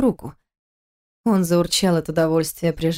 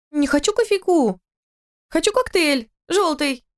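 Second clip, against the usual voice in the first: put-on high whiny tipsy girl; drunk girl whining; 9 semitones higher than usual. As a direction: whine like a drunk child.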